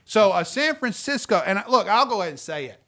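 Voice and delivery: ordinary talk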